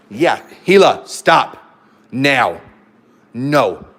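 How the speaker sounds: loud sharp stop command to the dog; firm scolding. Real, not acted. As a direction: call her off loudly.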